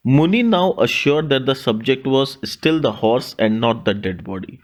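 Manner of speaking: ordinary delivery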